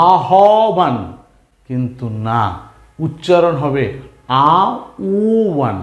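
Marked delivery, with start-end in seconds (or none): 4.5-5.8 slowed down. Shown as a demonstration. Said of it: "একটু slow করে বোঝার জন্য বলছি".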